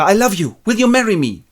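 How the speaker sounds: high, loud blurt; blurted proposal. Retold or imitated. Darcy proposing to Lizzie, high and loud. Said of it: "and he goes".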